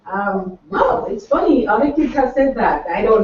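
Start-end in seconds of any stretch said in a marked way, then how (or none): none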